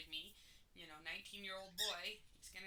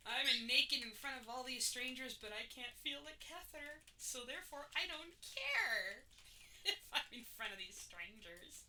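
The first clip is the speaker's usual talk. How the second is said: mock drugged euphoria; 5 semitones higher, 8 dB louder than usual. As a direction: replay your blissed-out self on the operating table. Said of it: "I said".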